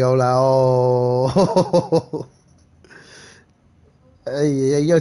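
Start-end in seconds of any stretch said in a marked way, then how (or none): 0.3-2.3 long, held reaction "oooh"; mock crowd-style "ohhh", very slow; drawn-out "oh". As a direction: stretch out a retold "oh" for ages. Retold crowd reaction to going crazy.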